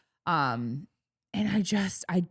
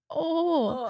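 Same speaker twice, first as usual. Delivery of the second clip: high, startled little oh; a high gasp of surprise; surprised oh; 10 semitones higher than usual. Retold or imitated. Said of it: "she was like".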